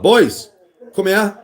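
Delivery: put-on loud, high-pitched summons to the boys; retold dad's shout across the house; calling out. Dad summoning sons to help decorate, calling out.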